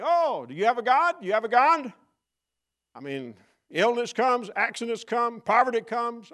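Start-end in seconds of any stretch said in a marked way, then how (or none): 0.0-1.9 higher, louder taunt; mocking cry. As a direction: throw it back as a sneer. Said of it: "it cries out saying".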